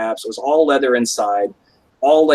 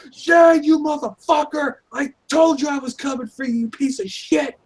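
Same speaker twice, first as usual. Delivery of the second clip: much higher shouted fury; screaming in rage; 13 semitones higher than usual. Retold or imitated. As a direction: scream it at him across the yard. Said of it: "I fucking screamed".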